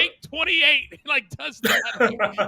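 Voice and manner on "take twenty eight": high, loud slate call; loud mock slate call; calling the take. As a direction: bark it out like an engineer calling a take.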